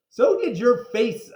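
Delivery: loud, high snappy retort; high, loud playground comeback; cheeky comeback. Acted. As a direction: fire it back like a cheeky kid. Kid retorting to Andrea.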